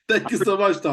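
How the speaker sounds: thanks said through a laugh, high and loud. Real, not acted. [name] thanking Tom warmly at the end of the call, laughing.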